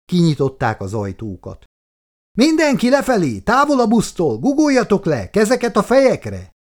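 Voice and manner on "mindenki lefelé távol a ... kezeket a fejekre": much higher, louder barked orders; urgent shout. A narrator's reading. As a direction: yell the commands to get everyone off. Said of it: "kiáltott a buszvezető".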